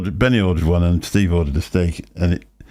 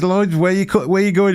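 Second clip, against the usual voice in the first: much higher, playful calling voice imitating a friend; high mock Hanky; 12 semitones higher than usual. Friend chasing Lloyd round the expo, high teasing call. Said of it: "he says".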